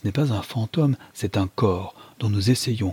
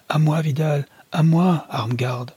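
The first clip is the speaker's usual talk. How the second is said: calling for help; 7 semitones higher than usual. A narrator's reading.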